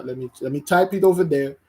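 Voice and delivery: ordinary talk, nothing marked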